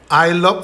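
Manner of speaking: "love" led in by 을, "al-lov"; clear L. Shown as a demonstration. Teacher showing how old TV shows spelt it, clear L. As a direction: hold a doubled L at the start of "love". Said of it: "을럽하면 알럽".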